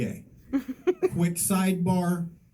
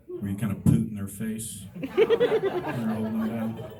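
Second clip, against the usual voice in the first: ordinary delivery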